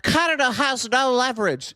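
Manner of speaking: high, scornful parroting of a know-it-all; mocking pundit. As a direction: parrot the claim with a sneer.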